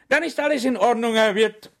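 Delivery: high, loud, gleeful officer; cheerful. Acted. Police agent tells the landlord cheerfully, high and loud. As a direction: beam it out, pleased with the catch. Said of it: "sagte Brettschneider heiter".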